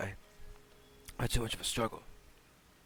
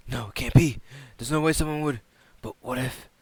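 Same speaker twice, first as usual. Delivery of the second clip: ordinary delivery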